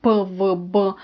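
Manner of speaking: P, V, B said as bare stressed consonant sounds; tense the mouth on P, V, B; firm consonant sounds P, V, B with a short vowel release; stronger tensed consonants. Shown as a demonstration. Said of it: "they do need to be stronger".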